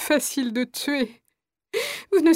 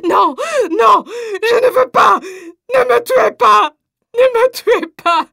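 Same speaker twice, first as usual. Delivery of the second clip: loud, high, slow screams of terror; terrified screaming; 7 semitones higher, 6 dB louder, slower than usual. Acted. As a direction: scream for your life as soldiers drag you. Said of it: "Elle résiste aux soldats en hurlant".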